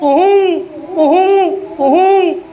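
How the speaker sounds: long hooting koo-hoo of a koyal; cuckoo call. Shown as a demonstration. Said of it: "કોયલનો અવાજ કાઢો". (કોયલનો ટહુકો)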